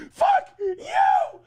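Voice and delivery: very high mock scream. Nick screaming before storming upstairs, very high mock scream. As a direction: shriek it like a tantrum. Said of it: "he screams".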